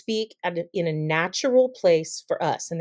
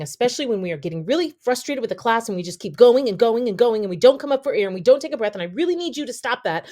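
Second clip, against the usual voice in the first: fast, no breath between phrases; breathless rush; 4 semitones higher, 3 dB louder, faster than usual. Shown as a demonstration. Teacher acting a frustrated teacher who never breathes. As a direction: run on without stopping for air. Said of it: "And then we take a breath".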